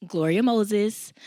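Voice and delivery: ordinary talk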